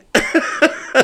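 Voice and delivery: high-pitched laugh; real laugh. Real, not acted. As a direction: laugh at your own bitter joke. Laughs at own bitter joke.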